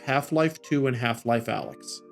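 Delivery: ordinary delivery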